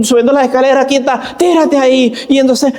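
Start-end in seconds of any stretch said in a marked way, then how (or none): 1.4-2.1 mock angry parent. Angry parent voiced in story.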